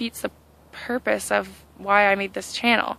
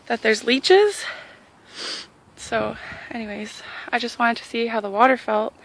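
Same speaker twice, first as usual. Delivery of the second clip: ordinary delivery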